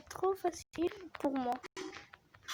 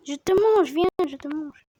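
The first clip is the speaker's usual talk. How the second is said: loud taunting; 8 dB louder than usual. Real, not acted. Taunting prey while playing shark.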